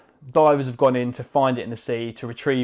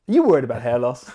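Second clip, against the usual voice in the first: loud, punchy TV advert announcer; American ad voice; 6 dB louder, faster than usual. Pretend. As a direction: sell it like a cheesy American commercial. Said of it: "A really American style advert".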